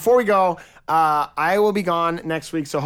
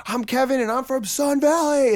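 mock youth pastor intro; 10 semitones higher than usual. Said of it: "like, you say".

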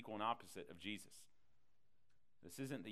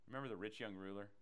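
ordinary delivery

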